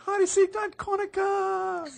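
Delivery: very high, loud, slow announcer cry; mock Japanese announcer. Hearing the video through a friend's mic, very high loud mock announcer. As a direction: stretch it out like a hyped announcer. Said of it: "someone is like".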